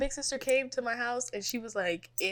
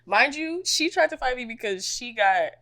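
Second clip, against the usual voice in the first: normal talking voice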